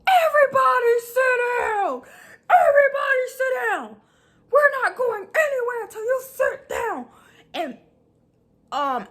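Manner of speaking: mock the coach shouting the whole bus down; fussing coach. Coach on the bus, yelling at the team to sit down. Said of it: "my coach fussing at this point".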